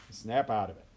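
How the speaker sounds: normal talking voice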